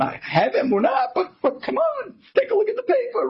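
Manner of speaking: very high, half laugh half sob; a man torn between laughing and crying; laughing through tears. Acted. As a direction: break between a laugh and a cry. Man laughs and cries to Rebbe.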